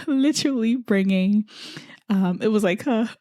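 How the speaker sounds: ordinary talk, nothing marked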